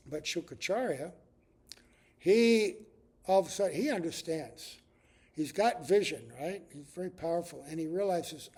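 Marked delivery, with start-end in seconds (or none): none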